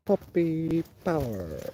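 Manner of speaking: a lightly voiced cartoon pup, testing the line; Scrappy Doo impression. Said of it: "doing a good impression of him".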